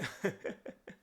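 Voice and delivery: high breathy burst of a laugh; short laugh. Real, not acted. Nervous laugh about botching carving.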